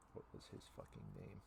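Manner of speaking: whispered to self; hushed whisper, thinking aloud. Real, not acted. DM forgets an NPC name.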